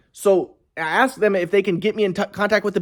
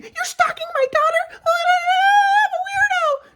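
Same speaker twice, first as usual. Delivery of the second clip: mocking his ex. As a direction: drag it out in a squeaky falsetto, making fun of her. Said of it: "she's thinking".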